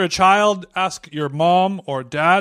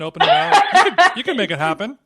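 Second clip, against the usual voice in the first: laughing; 7 semitones higher than usual. Real, not acted. Joking about trapped hand, laughing.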